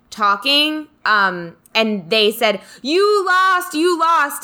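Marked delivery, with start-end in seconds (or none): 2.8-4.4 high, sing-out taunting call; crowing like a rival supporter; mock fan taunt. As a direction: imitate gloating fans, pitched way up. French fans taunting after the game.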